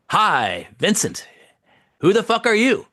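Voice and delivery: high-pitched, charming brashness; cheekily charming. Retold or imitated. Actor greeting a stranger at the table read, cheekily charming. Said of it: "in a kinda charming way".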